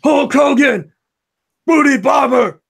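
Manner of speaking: high shouted wrestler growl; Zeus voice. Pretend. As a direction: bellow it like a movie heavy. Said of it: "can you only answer like Zeus would in the No Holds Barred movie".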